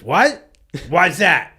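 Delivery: acted-out indignant yell; loud mock challenge. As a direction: shout it back as a challenge. Retold road confrontation, friend challenges.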